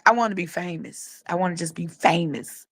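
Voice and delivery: re-enacted prayer, low and soft; quiet retold prayer. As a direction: drop the voice as if confiding in God. Retold old prayer to God.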